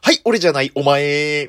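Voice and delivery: high, loud, pointing-out schoolboy jeer; cocky schoolboy. Retold or imitated. Schoolboy blaming a classmate for the broken vase, loud and cocky. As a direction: blame a classmate like a smug kid. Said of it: "いちびってる男子みたいな".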